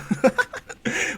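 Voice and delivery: high, airy burst of laughter; amused laugh. Real, not acted. Narrator cracks up at Mount Lady's line, amused laugh. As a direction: laugh at your own joke.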